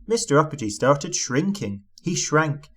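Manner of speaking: ordinary delivery, nothing marked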